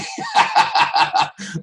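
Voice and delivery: high, loud laughing burst. Real, not acted. Preacher laughing hard at the thought, loud laugh. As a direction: let out a big laugh.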